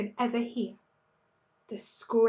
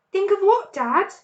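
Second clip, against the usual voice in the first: eager cub, lightly voiced; 9 semitones higher, 9 dB louder than usual. A narrator's reading. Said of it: "cub asks".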